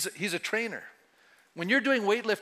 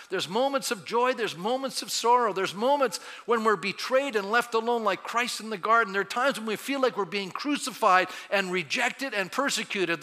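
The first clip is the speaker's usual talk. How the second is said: ordinary talk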